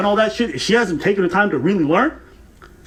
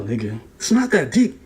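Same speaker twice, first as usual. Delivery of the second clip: usual speaking voice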